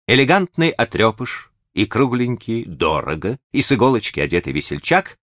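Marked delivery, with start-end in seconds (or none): none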